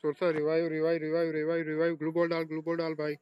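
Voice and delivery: urgent repeated calls; panicking gamer. Real, not acted. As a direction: chant it fast and urgent. (घबराया हुआ gamer)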